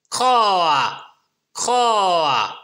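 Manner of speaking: cawing like a crow. A narrator's reading. Storyteller cawing for the crow. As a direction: croak it like a crow.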